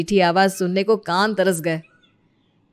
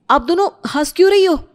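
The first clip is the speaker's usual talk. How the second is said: angry rebuke; 7 semitones higher, 4 dB louder than usual. Acted. Groom scolds laughing sisters.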